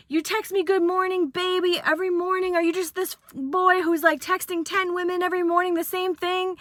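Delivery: high accusing rant; mock jealous ex. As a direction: call out the cheater. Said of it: "She's like".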